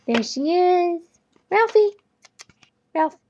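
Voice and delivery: loud, high-pitched call; calling the dog. Real, not acted. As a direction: call out brightly to get a pet's attention.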